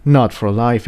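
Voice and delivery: plain reading voice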